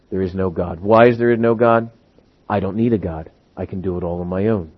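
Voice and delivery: low, hushed self-sufficiency; proud person. Acted. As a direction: state it softly, deep in your register. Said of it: "a proudful person stands up and says".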